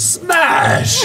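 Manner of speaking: very high shouted "Smash!"; sudden high-pitched exclamation; imitated crash. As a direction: burst out with the crash. Imitates crash heard by brother.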